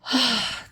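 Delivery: soft self-calming sigh; deep calming sigh. Real, not acted. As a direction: let out a long breath to settle yourself. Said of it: "Take a deep breath".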